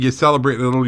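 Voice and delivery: normal talking voice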